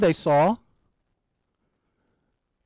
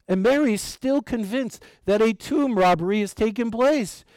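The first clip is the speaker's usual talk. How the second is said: ordinary talk, nothing marked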